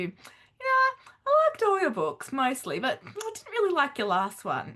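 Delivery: lightly mocked reader, high, hesitant opening; imitated tactless reader. Reader telling an author about her last book, mock-tactful.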